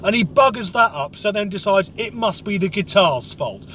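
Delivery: ordinary talk, nothing marked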